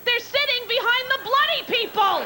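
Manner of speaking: high, exasperated snap; a mock outraged comeback; exasperated retort. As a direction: throw it back in disbelief.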